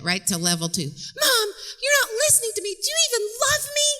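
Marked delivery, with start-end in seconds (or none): 1.2-3.9 put-on shrieky-high, fast teenage wail; ramped-up teen whine. Daughter ramping up the fight with Mom, melodramatic. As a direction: escalate into hammed-up adolescent hysterics. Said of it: "she's gonna ramp it up a little bit".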